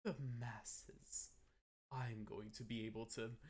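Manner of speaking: slow, gloating mock villain; hammed-up villain laugh into a drawn-out declaration; anime antagonist. Streamer showing off an antagonist look, mock anime villain. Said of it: "My sort of anime antagonist".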